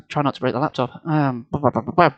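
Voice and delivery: ordinary delivery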